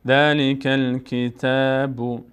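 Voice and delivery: ذلک الکتاب read very slowly, syllable by syllable; slow careful recitation. Shown as a demonstration. Teacher reads the verse slowly for students, careful recitation. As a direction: draw out ذلک الکتاب gently. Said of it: "پر خوانده نمیشه".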